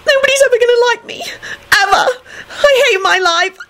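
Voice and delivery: very high, broken tearful wail; sobbing. A narrator's reading. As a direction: wail in bursts as if bawling. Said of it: "kept sobbing".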